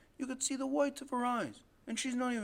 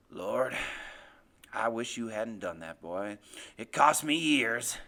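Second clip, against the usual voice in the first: low, heavy, regretful menace; sighing father; 10 semitones lower, 4 dB louder, slower than usual. A narrator's reading. Father laments what his son's cry cost him, low sigh. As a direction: sigh it out deep, growing louder at the end. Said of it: "My father sighs and says".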